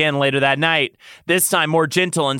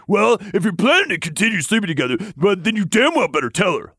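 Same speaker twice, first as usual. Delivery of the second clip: fast, higher-pitched scolding; growled; 7 semitones higher, faster than usual. A narrator's reading. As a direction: rattle off the rebuke. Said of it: "Lucian growled".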